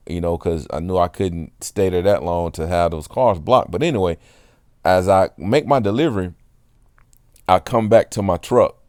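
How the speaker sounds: usual speaking voice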